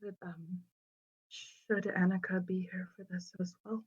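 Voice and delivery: soft, halting, near-whispered question; hesitant. Acted. Amelia asking whether Annika should be here, hesitant and hushed. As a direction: ask it timidly, barely above a whisper. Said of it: "she kind of hesitantly goes".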